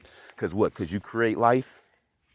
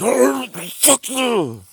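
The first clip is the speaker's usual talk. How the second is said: very high, drawn-out sneering fuck you; hammed-up high-pitched scoff; mock retort; 6 dB louder, much slower than usual. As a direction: squeal it out, mocking the listener's reaction. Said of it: "so they can just be like".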